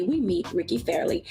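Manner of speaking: usual speaking voice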